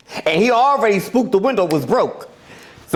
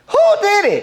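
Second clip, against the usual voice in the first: high, louder punchline; emphatic question; 4 dB louder, faster than usual. Real, not acted. Asking her friend the punchline, high and louder. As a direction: land the question high and loud.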